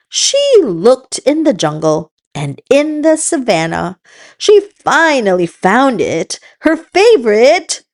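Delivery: plain reading voice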